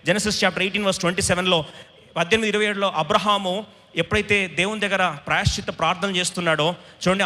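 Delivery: normal talking voice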